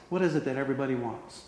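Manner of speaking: ordinary talk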